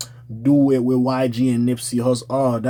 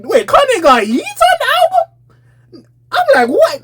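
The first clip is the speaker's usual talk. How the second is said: high, loud squealing disbelief; shocked disbelief; 5 dB louder, slower than usual. Retold or imitated. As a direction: squeal it like you can't believe it. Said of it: "I was sitting there like".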